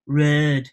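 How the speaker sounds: "road" with a long "er" vowel, like "rerd"; Hull pronunciation. Shown as a demonstration. Teaching Hull speech to a southerner, Hull vowel. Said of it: "we call roads road".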